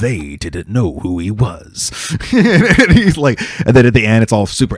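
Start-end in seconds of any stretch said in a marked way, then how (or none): 0.0-1.9 slow dramatic trailer narrator; movie trailer voice. Retold or imitated. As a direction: draw it out like an epic trailer. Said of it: "it's like".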